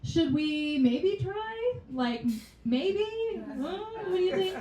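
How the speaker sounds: high, coaxing voice, slow on maybe; mock banking app. Voicing her banking app nudging her to save, high and coaxing. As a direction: coax her like a shy friend. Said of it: "is like".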